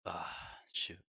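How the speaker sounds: breathy whispered sigh, holding back tears. Real, not acted. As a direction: let it slip out on a whisper, almost gone. Fighting tears saying goodbye.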